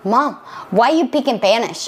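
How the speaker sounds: "speak in Spanish" with S dropped, "peak in Panish"; child's missing S's. Retold or imitated. Son asks mom about "Spanish", dropping the S into "Panish". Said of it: "he couldn't say his S's".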